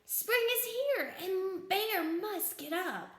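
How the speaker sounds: high, slow, insistent storybook lament; worried little mole voice; dismayed Mole. A narrator's reading. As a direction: stretch it out with exaggerated concern. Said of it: "sighed Mole".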